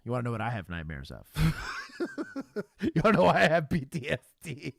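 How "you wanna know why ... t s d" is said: laughing. Real, not acted. Laughs at the news clip.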